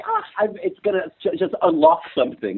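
high, lightly excited imagined thought; hopeful self-quote. Retold or imitated. Telling how he pictured Afrikaans coming back, mildly excited. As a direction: mimic past self's eager hope. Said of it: "And I'm like".

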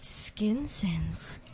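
soft unvoiced whisper. Real, not acted. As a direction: breathe the name. Whispered retry of name.

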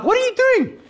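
very high-pitched incredulous yelp; mock alarm. Driver to attendant working on the car, high alarmed disbelief. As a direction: squeal it in comic disbelief.

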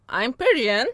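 ordinary delivery

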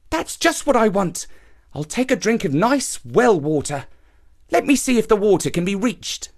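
musing happily to himself; young man. A narrator's reading.

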